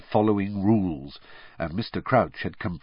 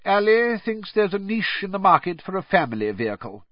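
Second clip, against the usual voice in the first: high-pitched, precise official voice; official Crouch; 11 semitones higher than usual. Acted. Crouch telling Weasley about the carpet trade, officiously. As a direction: report it in a raised official voice.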